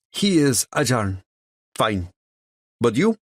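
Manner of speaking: put-on an impatient challenge in a thick Russian accent; Russian accent huff. A narrator's reading. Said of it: "she huffs".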